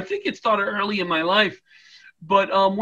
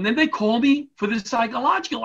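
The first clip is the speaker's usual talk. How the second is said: ordinary delivery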